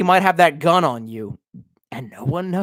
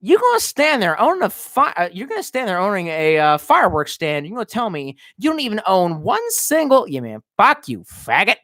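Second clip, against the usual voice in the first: ordinary talk, nothing marked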